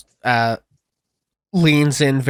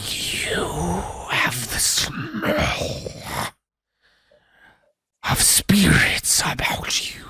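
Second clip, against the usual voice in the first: a hissing spirit's breath, drawn out; ghostly whisper; 5 semitones higher, much slower than usual. Acted. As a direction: whisper it slowly, like smoke in your face. Wraith speaks close up.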